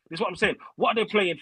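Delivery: ordinary talk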